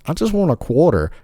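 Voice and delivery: put-on high squeaky old lady voice, pleading; mock old lady. Old lady begging McDonald's for a quarter, high mock voice. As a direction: mimic a meek old woman asking for a quarter. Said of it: "she was like".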